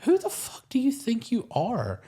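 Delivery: high, incredulous snap of outrage; mock outraged comeback; indignant retort. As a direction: fire back in disbelief. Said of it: "I was immediately like".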